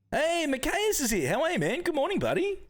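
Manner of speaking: high, bright hello to a friend; light up as a buddy arrives; excited greeting. Real, not acted. Streamer greeting a viewer who just joined, excited.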